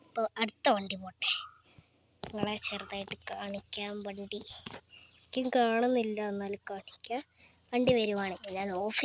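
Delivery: hushed whisper. Real, not acted. Vlogger whispering to viewers while waiting for a car, hushed. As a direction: whisper so the passing car isn't disturbed. Waiting quietly for passing vehicle.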